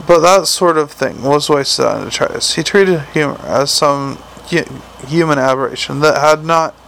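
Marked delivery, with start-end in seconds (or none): none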